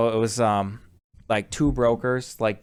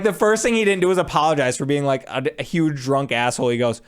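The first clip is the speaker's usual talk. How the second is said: ordinary delivery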